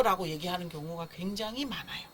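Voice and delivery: usual speaking voice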